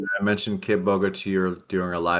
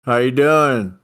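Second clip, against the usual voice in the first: loud, high farmer twang; country twang; 5 semitones higher, 8 dB louder, slower than usual. Acted. Farm partner greets the dealer, loud country twang. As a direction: holler a greeting in a put-on twang. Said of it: "I can do a little twang".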